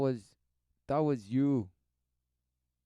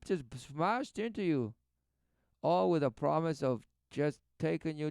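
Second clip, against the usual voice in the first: higher, louder sermon delivery; preaching; 6 semitones higher, 4 dB louder than usual. Acted. Commentator preaching repentance at the viewer, raised and urgent. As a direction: press it home like a preacher.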